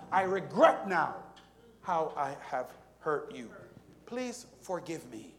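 ordinary delivery

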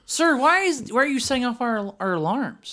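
high-pitched mock security guard; high squeaky guard.

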